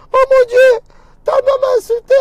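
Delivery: put-on high, loud, whiny child's complaint; a hurt kid crying tales; wailing child. An upset schoolchild complains about his daughter's mother.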